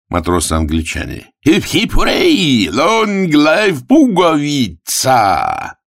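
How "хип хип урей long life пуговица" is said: high, drawn-out hurrah; cheering. Acted. English sailors hail the winning cockroach, high cheering. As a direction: cheer the winner high and long.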